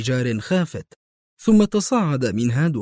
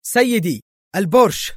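loud, high urgent call; excited raised cry; 7 semitones higher, 5 dB louder than usual. A narrator's reading. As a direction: burst out with breaking news. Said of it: "وهو يهتف".

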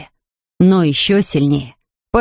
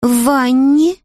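put-on higher, amazed girl; slowly repeating Judy; 7 semitones higher, slower than usual. Judy echoes the bear's words, higher and amazed. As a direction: echo it in disbelief. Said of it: "медленно повторила Джуди".

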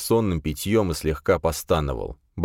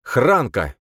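name called out loud, raised pitch; sudden loud, distinct exclamation; loud and clear; 6 semitones higher, 5 dB louder than usual. A narrator's reading. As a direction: blurt the name out clearly and forcefully. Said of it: "проговорить громко и чётко".

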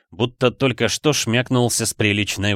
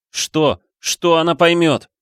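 high, louder outburst of indignation; angry cry; 8 semitones higher, 5 dB louder, slower than usual. A narrator's reading. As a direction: cry out in sudden fury. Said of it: "закричал Флинн". (возмущённый крик)

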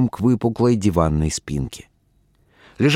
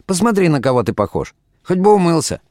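higher, brisk reproach; scolding wife; 8 semitones higher, faster than usual. A narrator's reading. Wife scolds unwashed husband, brisk and higher. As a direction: scold him quickly.

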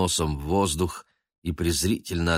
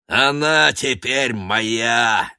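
slow, louder possessive gloat; gloating villain; 5 semitones higher, 5 dB louder, much slower than usual. A narrator's reading. Noir claiming Murianna, slow and gloating. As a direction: savour every word.

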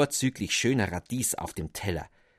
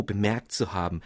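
usual speaking voice

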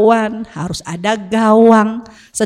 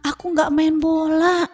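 put-on higher-pitched child's whiny protest; 5 semitones higher than usual. Son answers his scolding mother, in higher-pitched protest. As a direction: plead innocence like a scolded kid. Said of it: "apa kata anak saya".

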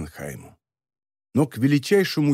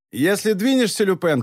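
higher, raised threat; shouting; 6 semitones higher than usual. A narrator's reading. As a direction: yell the warning with a gun drawn. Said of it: "крикнул Вебер".

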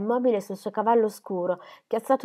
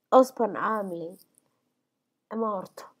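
gravely; slower than usual. A narrator's reading. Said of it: "rispose Cinzia gravemente".

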